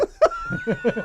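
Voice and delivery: a burst of high laughter; giggling at own story. Real, not acted. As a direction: laugh at yourself. Laughs at falling asleep.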